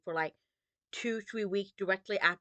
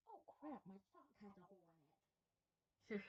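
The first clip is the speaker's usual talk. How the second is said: softer muttered aside to herself; quiet aside; 27 dB quieter, much faster than usual. Real, not acted. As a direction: say it under your breath. Muttered aside about sock hole.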